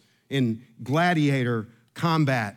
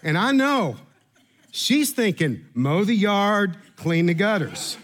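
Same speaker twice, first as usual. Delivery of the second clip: talking through laughter, higher; laughing; 4 semitones higher than usual. Real, not acted. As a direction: giggle through the punchline. Laughing while telling joke.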